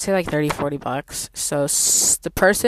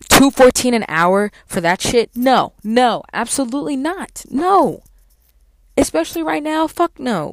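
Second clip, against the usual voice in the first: loud indignant refusal; 7 semitones higher, 8 dB louder than usual. Real, not acted. Ranting about low hourly pay.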